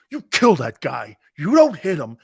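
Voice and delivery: ordinary delivery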